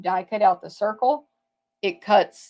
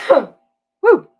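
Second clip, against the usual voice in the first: cough then woo; 10 semitones higher, 12 dB louder than usual. Real, not acted. Recovering after a sneeze.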